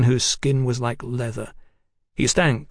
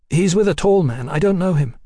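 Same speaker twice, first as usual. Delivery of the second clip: high, louder woman's voice; nervous woman; 6 semitones higher, 4 dB louder than usual. A narrator's reading. Woman questioned about Houston's companion, nervous. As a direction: answer anxiously, pitched right up.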